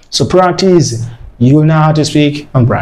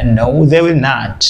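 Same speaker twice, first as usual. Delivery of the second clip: ordinary talk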